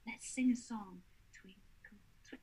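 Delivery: soft, breath-only whisper. Shown as a demonstration. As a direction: whisper it gently to a spirit child. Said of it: "And so I'll whisper".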